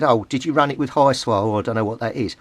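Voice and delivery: ordinary talk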